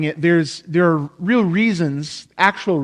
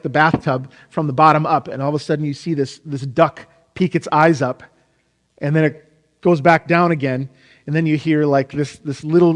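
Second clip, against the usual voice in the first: usual speaking voice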